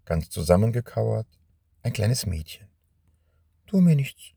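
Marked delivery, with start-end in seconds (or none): 3.7-4.3 put-on high, pleading little girl's cry; frightened plea. Scared little girl begging the stranger not to hurt her. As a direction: beg him not to hurt you. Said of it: "rief sie".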